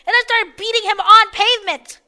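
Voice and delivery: usual speaking voice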